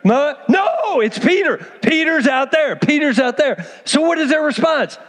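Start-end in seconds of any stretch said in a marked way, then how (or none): 0.0-3.7 put-on a girl desperate to be believed; excited insisting. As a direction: plead that it's really him, near shouting. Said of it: "She's like".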